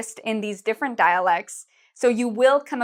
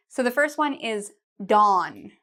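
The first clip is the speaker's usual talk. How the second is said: normal talking voice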